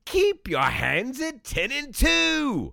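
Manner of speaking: high, hammed-up Australian accent; shitty Australian accent. Wallaby Wanker greeting podcast viewers in a hammed-up Australian accent. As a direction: play a cheesy Aussie safety mascot. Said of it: "your shitty Australian accent".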